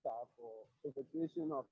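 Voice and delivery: very faint, far-off voice; speaking too softly into a weak mic; barely audible. Real, not acted. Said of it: "You sound very faint".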